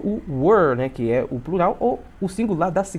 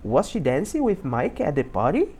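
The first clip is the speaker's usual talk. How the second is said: sing-song question rising to the end of "party"; question intonation. Shown as a demonstration. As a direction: demonstrate the question tune, lifting "party". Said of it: "tem que fazer essa entonação". (entonação de pergunta, voz sobe em "party")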